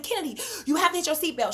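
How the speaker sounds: re-enacted hurried plea; urgent order. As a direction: rush it out to a child in danger. Urgent order to daughter in smoking car.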